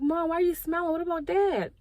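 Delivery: begging mom to take it seriously; panicked plea. Retold or imitated.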